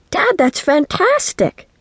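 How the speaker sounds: high, stretched-out delight; thrilled. A narrator's reading. Child hears dad's big news, thrilled.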